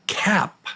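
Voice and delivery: "cap" said high with a flat front "a"; quiz word demo. Shown as a demonstration. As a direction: lift the pitch and say "cap" clearly. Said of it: "The word again is".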